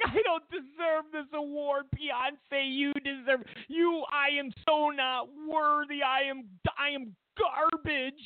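high, simpering self-abasement; high mock-humble acceptance speech; mock grovelling winner. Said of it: "and be like".

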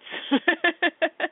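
breathy little laugh; short, amused chuckle. Real, not acted. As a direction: laugh at the big number. Laughs at her big number.

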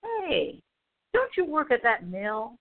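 high, sly "Hey" opening the question; mock-casual intimidating sheriff; smug sheriff. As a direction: sheriff taunting the father, lightly voiced smugness. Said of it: "All he had to say was".

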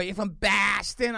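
high, drawn-out Boston caricature; slow, hammed-up Boston accent; exaggerated Boston accent. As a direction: mimic people overdoing a Boston accent. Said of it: "I don't think I qu-, said it quite like that".

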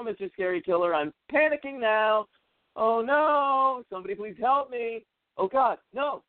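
high, fast, hammed-up panic; hammed-up scream-queen terror, high and breathless; mock-panicked cheerleader. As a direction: squeal like a helpless horror victim. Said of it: "what she says".

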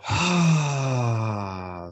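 long audible releasing exhale; dramatic sigh. Shown as a demonstration. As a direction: sigh it all out. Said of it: "big sigh".

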